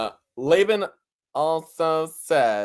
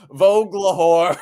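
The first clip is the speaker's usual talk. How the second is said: laughing announcement; 4 semitones higher, 6 dB louder than usual. Real, not acted. Announcing drag name, laughing.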